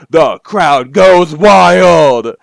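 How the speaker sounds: loud, drawn-out party-announcer yell; hype shout. Acted. Player narrating the drop to a dancing crowd, hyped shout. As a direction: stretch it out loud as the beat drops. Hyping the crowd as beat drops.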